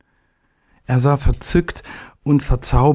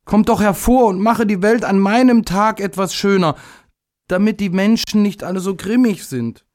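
put-on high, childlike plea; little day pleads for a nicer world; begging little day; 10 semitones higher than usual. A narrator's reading. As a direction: coax the sun out like a small child. Little day begs the sun.

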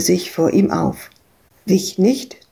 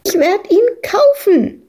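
shrieking; 12 semitones higher than usual. Acted. Madita shrieking against Freak's shouting. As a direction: screech it back defiantly. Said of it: "kreischte Madita".

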